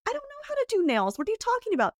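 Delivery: high-pitched mimicked protest; mock bafflement. Makeup artists refusing to do nails, mock bafflement.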